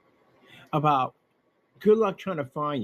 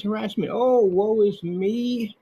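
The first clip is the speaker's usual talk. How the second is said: mock whiny complaint; 5 semitones higher, slower than usual. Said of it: "he now screams".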